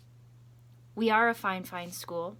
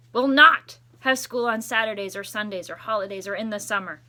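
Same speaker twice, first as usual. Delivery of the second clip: much louder grand declaration; proud announcement; 11 dB louder than usual. Acted. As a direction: boom the good news. Big announcement no school.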